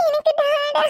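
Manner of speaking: put-on high, tearful child's wail; wailing through tears; crying. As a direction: sob out a plea for daddy. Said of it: "பாப்பா அழுதுட்டே இருக்க".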